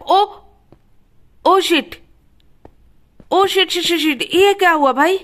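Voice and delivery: real startled dismay at the glitch; shocked panic. Real, not acted. Sudden game glitch panic. (घबराकर चौंकी हुई आवाज़)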